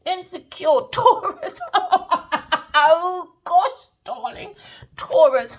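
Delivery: high, loud laughing jab. Real, not acted. Laughing at the insecure Taurus, high and loud. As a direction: burst out laughing mid-insult, slowly.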